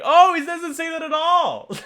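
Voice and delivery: high, loud mock exasperation; exasperated outburst. Retelling a correction to idiot brothers, high exasperated outburst. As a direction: squeal it in comic disbelief. Mimicking exasperated reaction to childhood mishearing.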